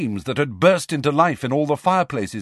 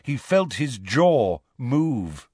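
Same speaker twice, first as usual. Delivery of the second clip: usual speaking voice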